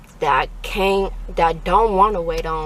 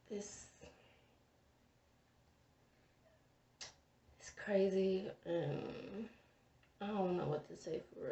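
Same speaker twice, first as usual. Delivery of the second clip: soft whispered aside; whispering; 20 dB quieter than usual. Real, not acted. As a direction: drop to a whisper. Whispers while filming.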